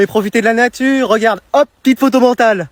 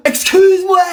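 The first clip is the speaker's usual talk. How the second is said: shocked excuse-moi; 10 semitones higher than usual. Acted. Shocked at little brother's demand.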